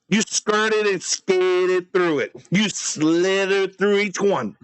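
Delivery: very slow, stretched words in mock outrage; slow, slithering pretend indictment; drawn-out accusation. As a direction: drag out each verb accusingly. Mock-rage accusation repeated, drawn out.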